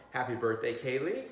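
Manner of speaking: ordinary delivery, nothing marked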